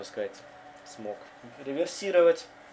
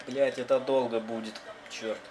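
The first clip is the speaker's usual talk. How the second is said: ordinary talk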